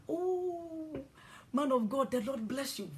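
high, gushing churchgoer greeting; mock devout church greeting; reverent greeting. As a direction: hail the bishop with awe. Greeting the bishop, imitated.

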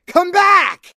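loud high call after someone running off; calling after her. Acted. Marco shouts after Star as she runs away.

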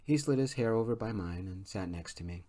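ordinary delivery, nothing marked

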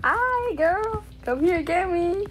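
high singsong taunt. Real, not acted. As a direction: tease the hunter to come get you. Taunting the chaser in game.

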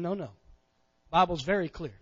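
ordinary talk

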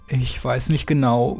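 usual speaking voice

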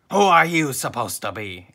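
loud storybook ghost demand; booming voice. A narrator's reading. Flying Dutchman looking down at SpongeBob, booming voice. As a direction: project it at the listener. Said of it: "in a booming voice".

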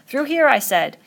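high, loud, commanding order; insistent shout. Acted. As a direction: bark the order impatiently. Insisting on order amid hunt.

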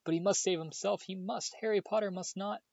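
very high, pressing elf plea; high urgent elf voice. A narrator's reading. Dobby urges Harry to leave, very high and urgent. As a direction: urge him away desperately.

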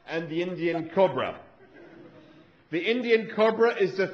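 a fussy, grumbling Australian scientist; Australian accent. Acted. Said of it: "my Australian accent isn't very good".

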